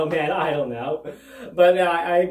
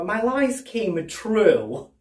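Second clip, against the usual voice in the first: stunned; 4 semitones higher, much slower than usual. Real, not acted. Stunned realization.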